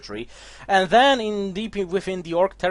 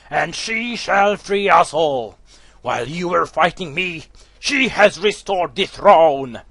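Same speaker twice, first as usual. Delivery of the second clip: loud, slow villain taunt; Akane's gloating villain voice; 4 dB louder, slower than usual. Acted. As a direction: sneer at them as a zealot. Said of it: "the Akane voice, come on".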